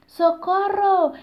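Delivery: high, loud, drawn-out cry; frightened cry for help. Acted. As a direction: scream for help, slowly. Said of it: "gritaron los animales". (grito de auxilio agudo y fuerte)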